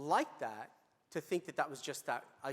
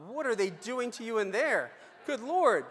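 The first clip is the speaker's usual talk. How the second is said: loud, much higher cry of disbelief; aghast exclamation; 8 semitones higher, 10 dB louder than usual. Retold or imitated. Doctor appalled by what the nurse endured, loud and high. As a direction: blurt it out, louder and higher, appalled. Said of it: "And I thought".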